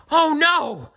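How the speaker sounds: high loud frightened cry; gasp. A narrator's reading. Toad crying out in fright at the lorry, high and loud. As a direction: panic as the lorry comes. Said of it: "gasped Toad".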